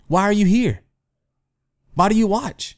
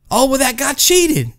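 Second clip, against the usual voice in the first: whiny accusation; 9 semitones higher than usual. Retold or imitated. Walker accuses runner of cheating.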